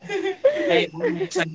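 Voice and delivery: high laughing call out. Real, not acted. Inviting the neighbors, laughing high and loud. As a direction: squeal it through laughter.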